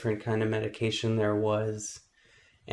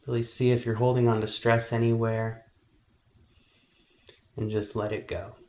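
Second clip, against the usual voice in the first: guided meditation. Acted. Meditation teacher helping kids focus on their breath, calm guiding voice. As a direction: guide them calmly through each breath, unhurried.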